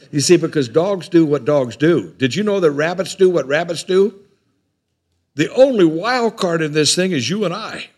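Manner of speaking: loud, high, ringing preacher voice; preaching at full force. Acted. Preacher to congregation, loud and high. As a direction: drive the point home to the congregation.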